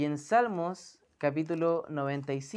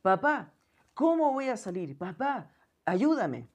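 put-on higher, louder frightened child calling for papá; a child's panicked cry for help; 3 semitones higher, 4 dB louder than usual. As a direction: call out like a scared child trapped in a fire. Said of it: "Empezó a exclamar el niño".

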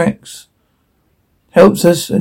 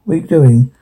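ordinary talk

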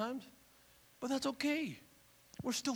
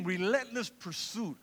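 ordinary talk

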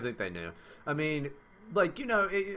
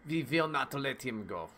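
put-on hammy German accent with v sounds; mock German, hard v; 2 semitones higher than usual. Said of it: "It's weird accent, though".

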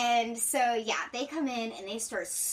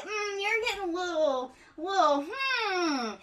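imitating someone delicately disapproving; hedging disapproval; 10 semitones higher than usual. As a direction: hum and hedge in a high voice. Acting out superiors' disapproval of ideas.

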